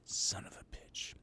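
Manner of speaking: hissed whisper under the breath; whispered curse. Acted. Streamer whispering a curse after being trolled. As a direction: whisper it in disbelief.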